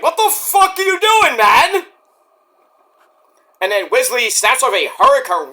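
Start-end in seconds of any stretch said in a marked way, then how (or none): 0.0-1.9 high-pitched incredulous squeal; referee's outburst. Retold or imitated. Referee yelling at the wrestler, high and incredulous. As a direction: shriek it like a baffled referee. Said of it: "Stefan's like".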